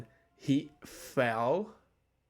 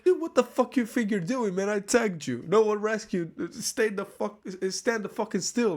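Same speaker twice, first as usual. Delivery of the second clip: mock angry yell; 6 semitones higher than usual. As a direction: yell at him to stand still, hammed-up. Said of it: "And I was like".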